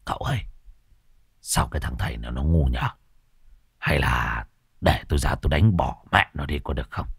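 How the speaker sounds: put-on Môn Phọt whispers to the young master, softly; whispered aside. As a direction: lean in and whisper. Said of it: "ghé tai cậu".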